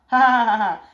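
loud, cruel cackle; mocking villain laugh. A boss laughing at a man crawling like a dog, cruel cackle.

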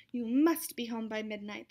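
ordinary delivery, nothing marked

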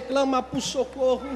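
normal talking voice

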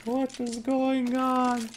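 very high, drawn-out laughing wail; helpless laughing. Real, not acted. Baffled caster to the viewers, helplessly laughing. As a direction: squeal it slowly through real laughter. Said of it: "I'm really sorry for laughing".

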